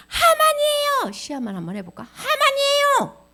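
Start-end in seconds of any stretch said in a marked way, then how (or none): 0.1-1.1 high, loud, drawn-out wail; accusing cry. Acted. Esther accuses Haman before king. 2.0-3.2 high, loud, stretched-out wail; accusing cry. Acted. Repeats Esther's accusing cry.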